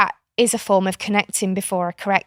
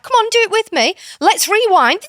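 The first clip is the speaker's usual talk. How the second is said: high, loud coaxing invitation; sing-song invite to join the game; playful coaxing; 12 semitones higher, 5 dB louder than usual. Acted. Aunt invites niece to rewind, high playful coaxing. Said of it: "I'm like".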